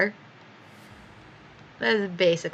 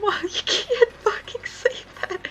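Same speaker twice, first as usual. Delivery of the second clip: high, slow, cracking with laughter; laughing hard; 13 semitones higher, much slower than usual. Real, not acted. Scolding her mom while helplessly laughing. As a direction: barely get the words out through laughter.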